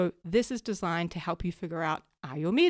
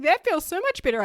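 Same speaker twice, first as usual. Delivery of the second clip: relieved; 10 semitones higher, 7 dB louder than usual. Retold or imitated. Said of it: "He's like".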